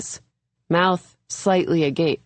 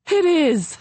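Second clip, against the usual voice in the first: high, bright little giggle on it; giggling agreement; 12 semitones higher, 3 dB louder than usual. A narrator's reading. Violet agreeing while still giggling over the disaster. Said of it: "still giggling".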